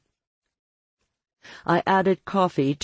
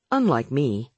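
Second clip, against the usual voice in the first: ordinary delivery, nothing marked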